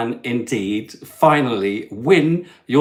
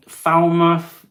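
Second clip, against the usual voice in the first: usual speaking voice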